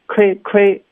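亏 as a short, high "kway", mouth barely open; quick closed-jaw 亏; no jaw movement. Shown as a demonstration. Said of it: "基本上没有动作". (嘴不张开、短促的 亏)

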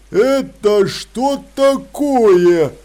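slow, loud, word-by-word troll voice; careful enunciation. Acted. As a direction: spell out every word heavily. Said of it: "старательно выговаривая слова".

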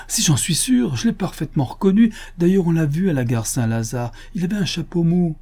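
a touch of excited conviction; insistent witness. A narrator's reading. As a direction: insist that you are absolutely sure. Witness insists she recognised the thief.

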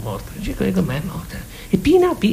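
usual speaking voice

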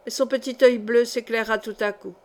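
plain reading voice

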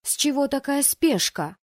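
high, indignant question; indignant high-voiced protest; angry challenge. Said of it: "гневно выпалила я".

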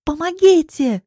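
high, loud call for help; cry for help. A narrator's reading. Woman being dragged away calls for help, high shout. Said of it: "кричу я".

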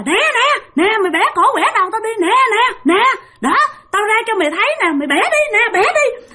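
high, mocking old ghost voice daring him; taunting ghost hag. Ghost dares him again. (giọng bà ma già cao vút, thách thức chế nhạo)

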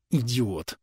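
soft, grumpy grunt; grumbled insult. A narrator's reading. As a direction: grumble it under your breath. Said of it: "буркнула она".

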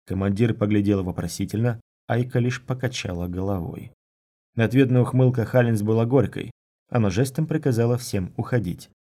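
plain reading voice

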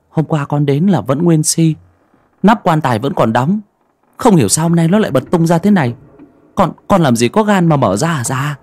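rushing to deny he opened it; frightened young man. A narrator's reading. Frightened boy defends himself. (giọng thanh niên sợ hãi, nói vội)

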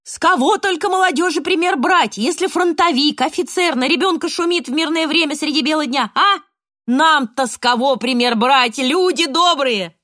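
high loud lament to the crowd; wailing. A narrator's reading. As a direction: wail it out like a street crier. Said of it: "вдруг заголосил".

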